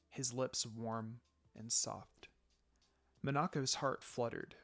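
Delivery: hushed, tender storytelling; soft romantic narration. A narrator's reading. Narrator softly telling the kiss to listeners. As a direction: read it close and gentle like a love scene.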